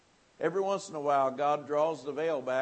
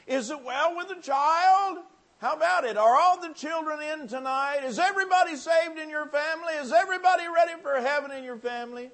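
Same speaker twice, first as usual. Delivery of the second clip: high, loud shouted preaching; preaching at full force; 13 semitones higher, 9 dB louder than usual. Acted. Preacher shouting about saved families to the congregation. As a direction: shout the altar call questions.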